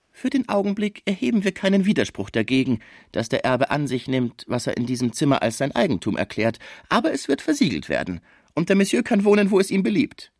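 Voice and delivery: schrecklicher Fraisier. Acted. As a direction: play the dreadful lawyer, pitched high. (schrecklicher Anwalt, hohe Stimme)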